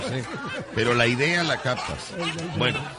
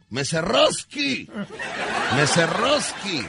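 arrastra "Meserowski" lento, fingiendo ruso; falso acento ruso; 7 semitones higher, slower than usual. Retold or imitated. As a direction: ruso inventado, lento y agudo. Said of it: "Le dijo". ([name] llama al mesero con falso acento ruso)

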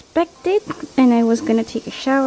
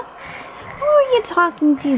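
higher, slower cooing question; 7 semitones higher, slower than usual. Real, not acted. Mom cooing at her baby about her noises.

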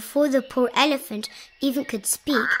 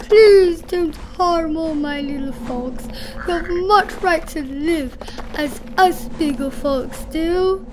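higher, louder desperate plea; begging; 6 semitones higher, 7 dB louder than usual. A narrator's reading. Elephant chasing the eagle to save little folks, begging. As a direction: implore on behalf of the helpless. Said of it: "and begged".

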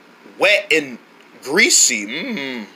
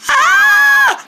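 very high squealed "ah"; failed angry "ah"; 4 dB louder than usual. Acted. Asked for an angry voice, high squeal instead. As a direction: try for anger, come out squealing. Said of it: "I need that angry voice".

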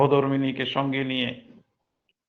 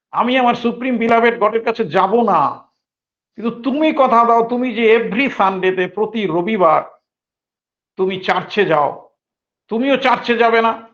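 raised, loud pleading challenge; defiant retort; 7 semitones higher, 3 dB louder than usual. Retold or imitated. Baba challenging his English boss, loud and defiant. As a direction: push back hard at the boss. Baba defies the sahib over leave.

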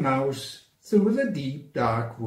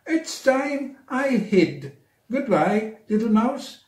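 scared snake; 8 semitones higher than usual. Acted.

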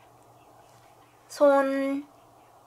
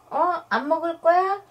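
high cooing pet voice; 6 semitones higher, faster than usual. Real, not acted. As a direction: coo at the dog. High baby talk to dog. (강아지한테 하는 아기 말투)

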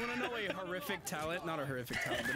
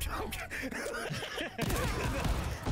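high cackling witch laugh; witch cackle; 11 semitones higher than usual. Shown as a demonstration. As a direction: cackle like an old hag. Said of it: "here's the witch laugh".

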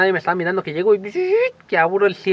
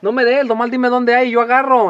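higher, begging little prayer to God; pleading prayer; 5 semitones higher, 4 dB louder than usual. Retold or imitated. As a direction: plead with God like a greedy kid. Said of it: "esa era mi súplica". (súplica aguda de niño)

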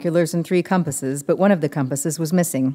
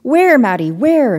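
urgent, higher and louder; eager; 9 semitones higher, 7 dB louder, slower than usual. A narrator's reading. Ellis asking Maddie where the monster is, urgent. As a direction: demand it, searching the water.

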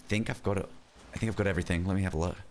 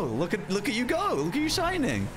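gushing praise; 10 semitones higher than usual. Real, not acted. Praising friend's impression.

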